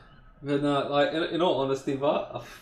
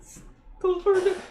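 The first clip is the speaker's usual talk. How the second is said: mocking echo; 3 dB quieter, slower than usual. Mocking his friend's words.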